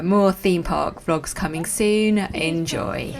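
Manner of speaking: ordinary talk, nothing marked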